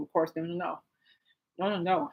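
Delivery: usual speaking voice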